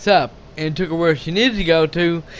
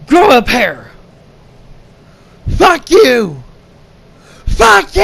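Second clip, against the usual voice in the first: louder and louder, ending in a high shouted fuck you; building from a loud snap to a full yell; woman yelling at the driver who fled, building to a shout; angry yell; 4 semitones higher, 5 dB louder, slower than usual. Retold or imitated. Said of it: "she yelled at him".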